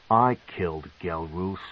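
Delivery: put-on a young drow claims the kill; Masoge's voice. A narrator's reading. Masoge steps in and confesses, cool and low.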